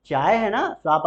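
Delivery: usual speaking voice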